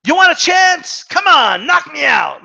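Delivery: mock boxer's taunt, shouted. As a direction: yell the challenge like a fighter inviting the punch. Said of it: "almost as if to say".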